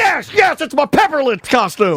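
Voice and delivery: high, overexcited cheering; mock glee. Co-host pretending to unwrap a Pepper Lips costume, hammed-up glee. Co-host acts out the gift opener's delight.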